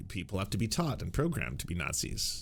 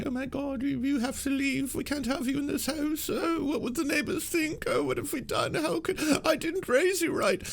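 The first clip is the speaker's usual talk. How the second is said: very high, falsetto mock wail; hysterical parent. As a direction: ham up a horrified mother. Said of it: "the family is like".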